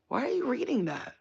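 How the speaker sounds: high-pitched, exasperated question; mock incredulous scolding. Scolding celebrities who read their comments, high mock incredulity. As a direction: pitch it way up in playful disbelief.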